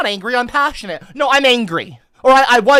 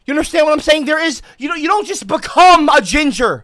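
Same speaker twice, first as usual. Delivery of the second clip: mock outrage; 6 semitones higher, 6 dB louder than usual. Mock outraged rant about gingers.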